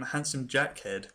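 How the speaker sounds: normal talking voice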